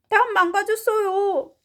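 put-on high, loud child voice; high-pitched baby character; story baby. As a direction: exclaim like a little child. Baby's line in translation.

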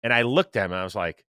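normal talking voice

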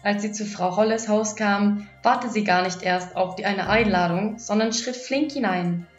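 usual speaking voice

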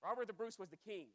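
usual speaking voice

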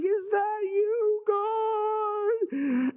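high, drawn-out wail; frightened calling out. Acted. Elijah calls to God in the wind, wailing high. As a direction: call out slowly, very high, to be heard over the storm.

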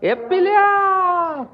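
high, slow, stretched call to the kitten; drawn-out coo. Real, not acted. Calling the kitten by name, slow high coo. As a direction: draw the kitten's name out sweetly.

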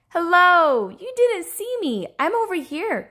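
high, loud singing-out hello; gleeful cry. Acted. Hidden fish revealing itself to the seeker, gleeful cry. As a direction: call out high and triumphant. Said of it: "cried the fish with the jagged fins".

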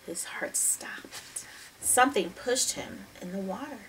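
breathless suspense whisper; hushed whisper. A narrator's reading. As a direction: whisper it like holding your breath. Suspense read, gasp before.

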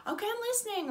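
high, sweet imitation of a star; put-on pop-star lilt, pitched right up. Star urges the fan to tell her story, high impression. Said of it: "And a bit more [name]-y".

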